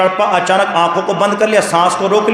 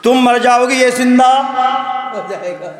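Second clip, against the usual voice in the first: laughing repeat; 3 semitones higher than usual. Shown as a demonstration. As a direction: relish the punchline with a laugh. (ہنستے ہوئے دہرانا)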